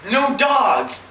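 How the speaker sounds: usual speaking voice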